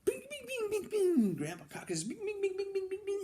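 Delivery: very high quick bings; rapid hammer pings. Acted. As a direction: rattle off fast tiny pings. Hammering everywhere fast.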